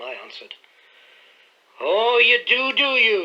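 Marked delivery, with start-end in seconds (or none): 1.8-3.2 loud, high snarling sneer; drunken butler's louder snarl. A narrator's reading. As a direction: snarl it back at him with menace. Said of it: "he snarled".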